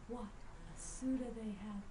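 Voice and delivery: plain reading voice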